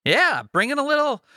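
high, peppy delivery; put-on energy. Host bringing energy to a tired co-host, forced upbeat. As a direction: pump up the energy on purpose. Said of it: "bring a little bit of energy".

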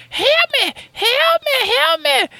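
very high, piercing falsetto cries of help me; damsel crying for help. Retold or imitated. Streamer's game woman calling out from the woods, high falsetto screams. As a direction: shriek it like a kidnapped woman on a horse.

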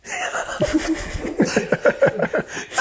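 high, airy giggling; helpless high chuckle; real laugh. Real, not acted. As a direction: laugh at your own past self. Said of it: "I laugh now".

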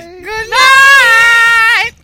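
loud cheerful goodbye shout; shouted good night. Real, not acted. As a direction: call it out loud. Shouted farewell to listeners.

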